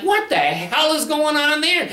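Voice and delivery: very high incredulous question; baffled onlookers. Retold or imitated. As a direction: sound completely bewildered. Bystanders puzzled at rink.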